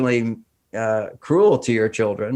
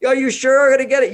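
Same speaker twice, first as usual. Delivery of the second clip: put-on anxious child; 12 semitones higher, 4 dB louder, faster than usual. Child asks parent.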